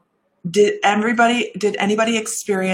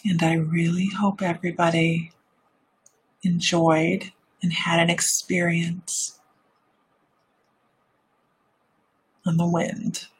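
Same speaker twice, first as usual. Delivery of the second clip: meditative voice. Shown as a demonstration. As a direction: ease them gently back to the room. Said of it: "let me get out of my meditative voice".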